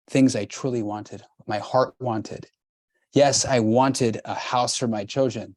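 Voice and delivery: ordinary talk, nothing marked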